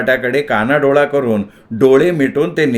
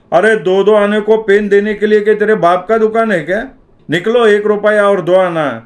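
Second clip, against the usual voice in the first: angry shopkeeper; 7 semitones higher, 3 dB louder than usual. A narrator's reading. Shopkeeper scolds the boy.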